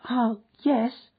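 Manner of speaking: put-on high, childlike voice of a small girl; a lost little girl asking after her mother. A narrator's reading. A small girl asking the stranded man for her mother.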